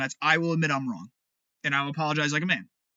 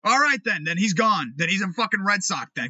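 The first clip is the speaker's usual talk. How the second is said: normal talking voice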